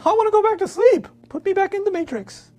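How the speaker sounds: sulky cousin pleading to stay asleep, whining high; whiny sleepy cousin. Acted. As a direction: beg to go back to sleep like a sulky kid. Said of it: "he sees the nightmare ... and he says".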